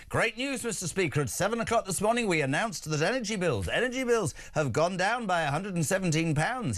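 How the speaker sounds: Starmer full of cheer. Retold or imitated. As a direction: announce it with put-on springtime glee. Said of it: "full of the joys of spring".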